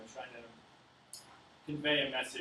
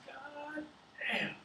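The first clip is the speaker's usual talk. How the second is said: God damn jumping much higher in pitch; amazed farmer; 12 semitones higher, 3 dB quieter than usual. Acted. John reacting to the race hog prize, high amazed exclamation. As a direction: let John's astonishment leap up high.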